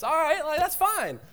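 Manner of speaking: high, exasperated give-up. Acted. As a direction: throw up your hands, frustrated. Acted couple arguing about dinner.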